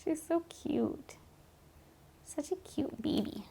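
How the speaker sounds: soft, high coo over a pet; slow, very soft adoring murmur; tender cooing. Real, not acted.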